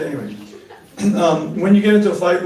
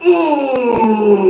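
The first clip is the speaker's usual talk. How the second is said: pained ooh; 6 semitones higher, 7 dB louder than usual. Acted. Reacting to wife's plea.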